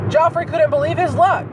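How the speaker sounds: usual speaking voice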